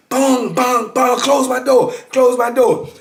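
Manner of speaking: high, loud yell while banging the door. Retold or imitated. Bleeding inmate yelling at the booth lady, loud high yell. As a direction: demand it, bang bang, close my door.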